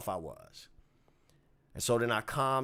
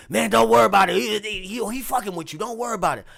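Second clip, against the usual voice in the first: dismissive bandleader; 9 semitones higher, 9 dB louder, faster than usual. Retold or imitated. Bandleader brushing it off.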